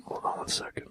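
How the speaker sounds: whispered aside. Real, not acted. Host stepping aside mid-podcast, real whisper. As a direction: breathe it under the mic.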